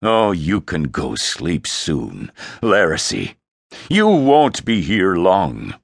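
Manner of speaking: scornful, deliberate dressing-down; dripping disgust. Acted. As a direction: berate your son with disgust, building in force. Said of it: "Frey's voice dripped disgust".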